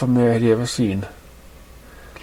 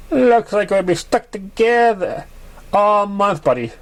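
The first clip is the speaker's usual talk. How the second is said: voiced pony friend; 9 semitones higher, slower than usual. Character voice, Thunderdale.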